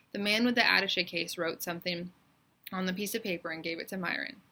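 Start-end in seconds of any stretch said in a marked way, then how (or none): none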